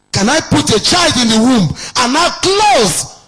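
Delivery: voicing God, rising. God voiced in scripture quote.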